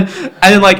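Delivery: ordinary talk, nothing marked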